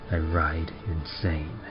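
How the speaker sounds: usual speaking voice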